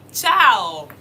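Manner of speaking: drawn-out ciao, slow and loud; slow, drawn-out teaching. Shown as a demonstration. Teacher saying ciao slowly for the learners. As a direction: stretch each sound so learners hear it. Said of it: "I'm saying it very slowly".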